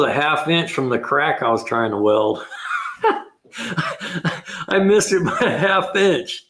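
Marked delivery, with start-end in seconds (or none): 2.5-3.2 short, high burst of laughter; real laugh, pitched up high; amused laugh. Real, not acted. Laughs at own welding mistake. 4.7-6.5 high-pitched, laughing confession; amused disbelief. Real, not acted. Laughing at own welding mistake.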